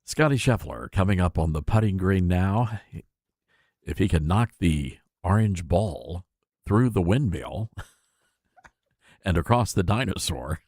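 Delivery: low, hushed golf commentator; golf announcer voice. Acted. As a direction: call it like a hushed golf broadcast. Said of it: "talk in a golf voice".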